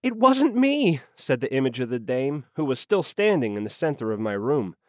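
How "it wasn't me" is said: high-pitched protest of innocence. A narrator's reading. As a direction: plead innocence in a high female character voice. Accused woman protests her innocence.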